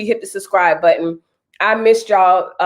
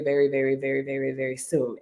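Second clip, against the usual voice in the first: fading to quiet; 4 semitones lower, 9 dB quieter than usual. Real, not acted. Fading repeated very.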